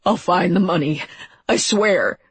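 frightened, urgent pleading; acted fearful begging; shuddering promise. A narrator's reading. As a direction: swear it like someone begging for his life. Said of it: "he said in a shuddering voice".

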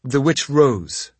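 ordinary delivery, nothing marked

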